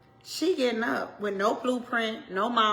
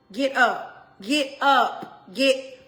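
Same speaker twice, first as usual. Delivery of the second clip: rousing call; 3 semitones higher, 6 dB louder, much slower than usual. Pretend. Voicing friends rousing her up.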